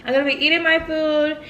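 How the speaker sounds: ordinary delivery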